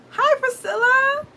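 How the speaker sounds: high, drawn-out happy hello; loud, high, stretched-out greeting; excited greeting. Real, not acted. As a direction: call out a delighted, singsong hi to someone just arriving. Greeting a viewer who arrives.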